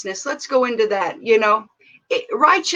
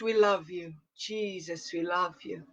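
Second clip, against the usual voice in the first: much softer, near-breathless whisper of love; hushed; 6 semitones lower, 13 dB quieter than usual. Real, not acted. Pastor quietly loving on Jesus after the outcry, hushed. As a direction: let the prayer sink to a hush.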